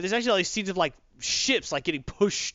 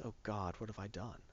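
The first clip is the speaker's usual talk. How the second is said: hushed, much softer broken plea, mildly tearful; retold crying; 6 semitones lower, 17 dB quieter than usual. Joker breaking down over beaten Batman, mild tearful hush. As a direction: let it crack quietly in disbelief. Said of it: "he kinda starts crying and says".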